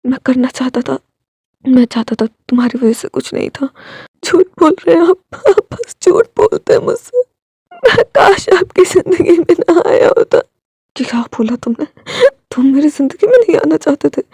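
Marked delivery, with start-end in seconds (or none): 4.2-10.4 higher, crying reproach; tearful accusation. Acted. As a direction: sob out that he's lying. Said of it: "वो रोने लगा था".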